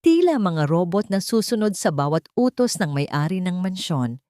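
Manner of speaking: ordinary delivery, nothing marked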